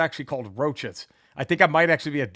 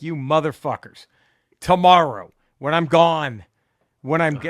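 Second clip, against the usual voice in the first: frustrated outburst; 8 dB louder than usual. Retold or imitated. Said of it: "I'm like".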